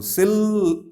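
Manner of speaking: "Sil" high and alone; isolated syllable. Shown as a demonstration.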